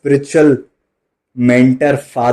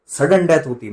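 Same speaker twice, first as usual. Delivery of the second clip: ordinary talk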